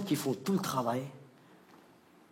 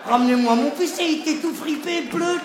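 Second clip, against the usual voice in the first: outraged demand; 8 semitones higher, 4 dB louder than usual. Acted. To doctor, outraged.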